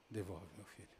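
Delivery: low, hushed plea; retold plea. Mother begging God to return her missing son, hushed plea. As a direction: plead softly, almost under your breath.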